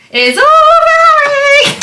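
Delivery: high, loud panicked shout; yelling across the room. Real, not acted. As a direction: shriek it as the water spills over. Steam oven overflowing.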